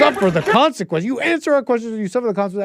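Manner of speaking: high-pitched mock protest chant; imitated heckler call; mimicking hecklers. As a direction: parrot the protesters' demand, pitched well up. Mimics hecklers in played clip.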